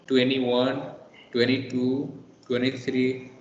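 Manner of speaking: "twenty-one" said tweny-one, T dropped; "twenty-two", "twenty-three" with no T after N; American twenties. Shown as a demonstration. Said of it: "they say".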